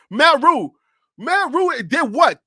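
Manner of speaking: ordinary delivery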